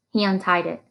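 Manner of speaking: ordinary delivery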